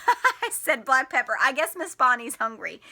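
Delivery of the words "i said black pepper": laughing. Real, not acted. Laughing at own misreading.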